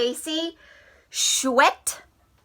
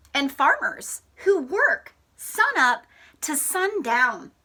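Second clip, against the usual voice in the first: story reading. Acted. Reads picture book aloud.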